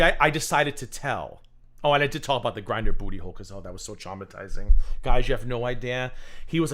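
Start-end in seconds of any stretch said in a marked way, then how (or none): none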